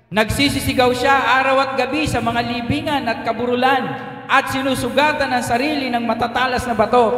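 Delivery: ordinary talk, nothing marked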